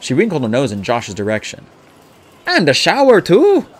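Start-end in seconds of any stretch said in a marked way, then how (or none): 2.5-3.6 pointed, higher and louder add-on; a loaded hint, pitched up; significant. A narrator's reading. Woman hinting that someone needs a shower, significantly. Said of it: "she added significantly".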